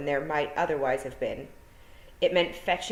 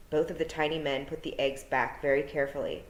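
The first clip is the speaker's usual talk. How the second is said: ordinary delivery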